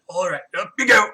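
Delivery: loud, effortful lifting call; straining bartender's heave-ho; bartender heaving. Acted. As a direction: say it as you haul a drunk to his feet. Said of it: "he just kinda stands up behind Lucius".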